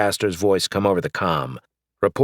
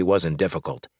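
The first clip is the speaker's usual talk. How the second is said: plain reading voice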